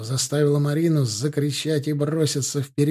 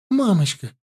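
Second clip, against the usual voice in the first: put-on high, louder child's scream; scared child cry; 6 semitones higher, 4 dB louder than usual. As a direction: cry out like a frightened girl. Child cries out.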